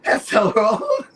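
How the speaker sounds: crying with laughter, high; gasping it out through laughter; laughing to tears. Real, not acted. Still in tears from laughing, protesting through laughter. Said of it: "He's crying".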